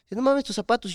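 put-on high, sneering kid voice; mocking bully. Schoolboy bully jeering at his orthopedic shoes, high sneer. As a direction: jeer at the shoes.

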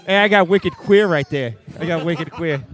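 loud, hammed-up Boston accent. Improviser joking to castmates about wicked queer, loud Boston accent. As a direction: holler it like a Boston local. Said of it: "if it's in a Boston accent".

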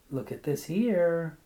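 a wife's small sing-song, showing him the paper. A narrator's reading. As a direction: coax it out in a little sing-song. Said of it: "in her small sing-song voice".

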